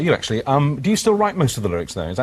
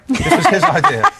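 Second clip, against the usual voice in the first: high, loud, laugh breaking through; real laughter bursting into the words; 11 semitones higher, 6 dB louder than usual. Real, not acted. Host owns up to the editor's joke idea, laughing.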